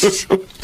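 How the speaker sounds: high, gloating villain laugh. Acted. Jimbo laughing at his trapped classmate, gloating high laugh. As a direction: laugh at her like you own her.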